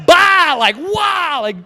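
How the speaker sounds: high, loud awestruck wow; amazed wow. Retold or imitated. Class stunned by Abraham, high and loud. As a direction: gasp it out in awe. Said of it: "reacting to the story".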